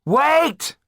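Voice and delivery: high sharp call to stop; shouted. Acted. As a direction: cut him off with a shout. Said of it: "shouted Jan".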